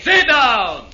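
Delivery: sharp furious command; angry order. Acted. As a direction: slam the order down. Ivan repeats his order.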